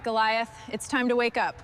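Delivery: ordinary talk, nothing marked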